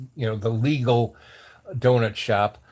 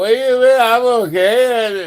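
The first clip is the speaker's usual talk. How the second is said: hammed-up stoner insisting all is fine; tripping protest; 10 semitones higher, 10 dB louder than usual. Mimics tripping self protesting to cop.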